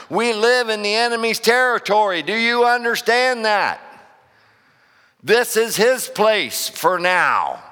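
high, loud preaching, building to a climax; full-force preaching. Acted. Pastor driving the sermon home to his church, full-force preaching.

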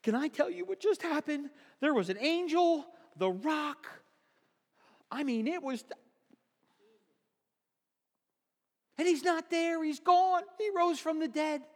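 high, breathless frantic telling; frantic report. Acted. Guards frantically reporting to elders, high excited voice. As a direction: blurt it out like a terrified witness. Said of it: "they're going".

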